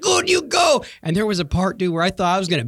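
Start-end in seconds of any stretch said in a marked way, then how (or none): 0.0-0.8 very high mock shout of an old man; dismissive old man. Old customer waving the pest guy off in a high squeak. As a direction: shoo him off in a high squeal. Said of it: "and he's like".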